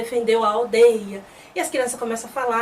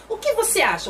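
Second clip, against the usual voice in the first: higher-pitched, testy comeback; proud retort; 9 semitones higher than usual. Retold or imitated. The chief answering Tien's question about duty, proud retort. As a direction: toss the question back with pride. Prideful retort, first take.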